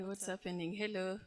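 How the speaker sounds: ordinary talk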